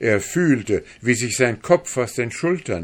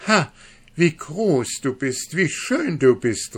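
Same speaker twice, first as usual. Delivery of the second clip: high, louder exclamation of admiration; amazed cry; 8 semitones higher, 5 dB louder than usual. A narrator's reading. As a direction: cry out in astonished delight. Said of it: "rief sie".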